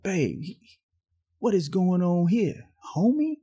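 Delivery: rising to a squeak on "Homie?"; incredulous. Retold or imitated. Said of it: "And I'm like".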